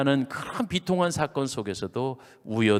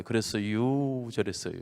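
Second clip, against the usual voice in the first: Chungcheong dialect; 5 semitones lower, 5 dB quieter than usual. Shown as a demonstration. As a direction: demonstrate a drawling Chungcheong lilt. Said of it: "쓰는 이 충청도 말이". (말끝을 늘이는 충청도 사투리로)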